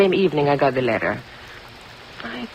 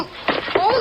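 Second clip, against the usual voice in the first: high enraged cry as she lunges; furious cry. Acted. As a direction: shriek of rage. Countess attacks, throwing things.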